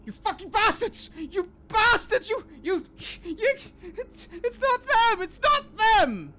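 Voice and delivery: high, loud, desperate yelling with a crying break; furious, near-tearful shouting; frantic screaming man. Acted. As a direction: scream it out, half sobbing, at the people he blames. GM voices Livio screaming.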